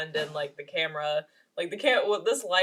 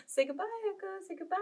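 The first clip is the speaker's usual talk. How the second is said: high, soft coaxing voice to a pet; puppy talk; 13 semitones higher, 5 dB quieter, much faster than usual. Real, not acted. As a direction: coo softly and high to the puppy. Coaxing her puppy to say goodbye.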